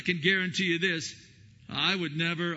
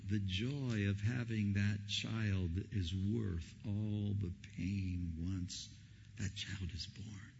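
slow, softer fading to a whisper; tender hush; 7 semitones lower, 8 dB quieter, slower than usual. Real, not acted. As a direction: let it fall to a hush.

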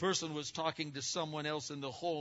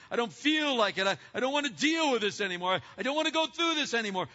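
louder and higher, pleading in mock prayer; struggling believer praying; 9 semitones higher, 8 dB louder, faster than usual. Believer wrestling in prayer before the Lord, pleading. Preaching at full force.

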